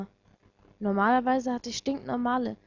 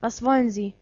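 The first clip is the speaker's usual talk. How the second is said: loud, curt-edged question; unfriendly; 5 dB louder than usual. A narrator's reading. Watari questions the stranger on the phone, unfriendly. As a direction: demand it with no friendliness. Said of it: "kein Deut freundlicher".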